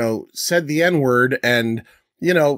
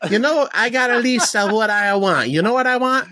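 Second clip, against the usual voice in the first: higher, hammed-up Italian prince voice; mock Italian accent; 7 semitones higher than usual. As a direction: lay the cartoon Italian on thick as a demanding prince. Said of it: "The, the Italian Saudi prince said".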